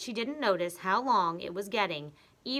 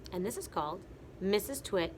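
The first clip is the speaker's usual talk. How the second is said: usual speaking voice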